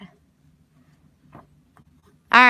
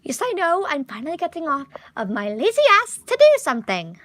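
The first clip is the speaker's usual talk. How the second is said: put-on American British accent; 6 semitones higher, faster than usual. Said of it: "He's, like, British or whatever".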